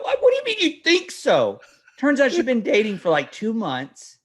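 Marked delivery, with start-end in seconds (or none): 0.0-1.6 very high, incredulous comeback; squeaky retold parental exasperation; exasperated disbelief. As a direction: throw the answer back in disbelief. Retelling exasperated reply to daughter.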